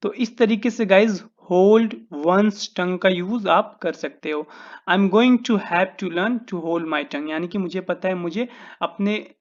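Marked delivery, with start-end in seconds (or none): 4.9-7.3 slow dictation. Shown as a demonstration. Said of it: "teacher dictates example sentence".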